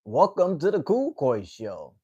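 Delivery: slow, drawn-out host welcome; show host intro. Acted. Host welcoming viewers to the show, slow announcer style. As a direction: stretch the words out like a show opener.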